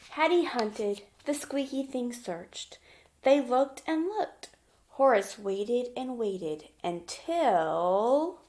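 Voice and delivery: put-on bright, lively read-aloud for little kids; gentle children's story narration; storybook reading. A narrator's reading. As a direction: read it like a picture book at story time. Reading picture book to children.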